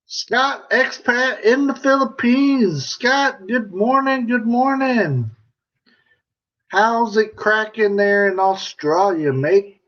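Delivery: high, bright-pitched mock Aussie greeting; a playful attempt at an Australian accent. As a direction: put on your best Australian twang. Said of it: "That's my best, uh, accent".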